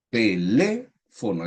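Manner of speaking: "teléfono" repeated slowly with a lifted, held LÉ; stress on LÉ. Shown as a demonstration. Teacher repeating the example for class, slow "teléfono" leaning on LÉ. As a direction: draw out LÉ in "teléfono". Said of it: "la sílaba tónica está ubicada en la tercera sílaba".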